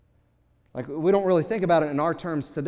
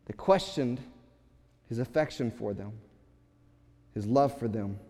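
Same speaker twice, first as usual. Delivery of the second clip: ordinary talk, nothing marked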